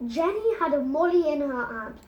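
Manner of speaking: usual speaking voice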